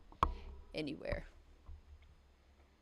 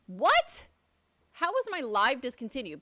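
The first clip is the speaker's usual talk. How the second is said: shocked what; 3 semitones higher, 7 dB louder than usual. Real, not acted. Sees live stream was discontinued.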